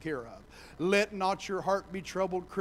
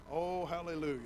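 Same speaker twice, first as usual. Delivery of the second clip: usual speaking voice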